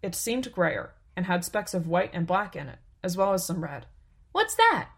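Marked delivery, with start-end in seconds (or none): 4.3-4.9 put-on curious child. A narrator's reading. Child sees strange rock.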